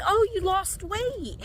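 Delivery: a hint of the nurse, drawn out; nurse's voice. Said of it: "So the lady was like".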